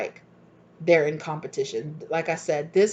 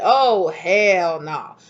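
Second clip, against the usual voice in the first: sassy refusal; 6 dB louder, much slower than usual. Retold or imitated. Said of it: "when you took your power back... you said".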